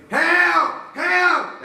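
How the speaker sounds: high, loud cry for help; mama crying for help. Acted. Mama screaming from the car window. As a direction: shout it like a panicked mother. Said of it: "she yelled".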